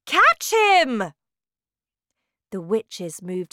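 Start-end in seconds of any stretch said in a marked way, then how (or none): 0.1-1.1 high, loud urgent order; commanding shout. A narrator's reading. Grand High Witch sends witches after boy, high shout. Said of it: "shouted the Grand High Witch".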